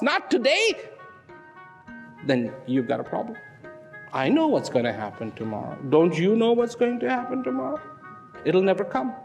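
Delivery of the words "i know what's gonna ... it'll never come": ordinary talk, nothing marked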